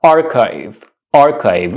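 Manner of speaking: archive said as AR-kyve; correct archive. Shown as a demonstration. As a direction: say "archive" with a K and long I, rhyming with five. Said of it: "This is the correct pronunciation".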